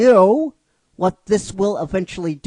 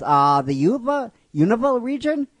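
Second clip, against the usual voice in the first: rising, higher-pitched question; questioning guess; 7 semitones higher, 4 dB louder than usual. Acted. She guesses where he is, questioning and rising. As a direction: guess aloud, climbing high at the end.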